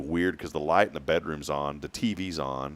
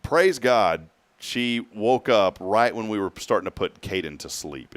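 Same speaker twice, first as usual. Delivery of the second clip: ordinary talk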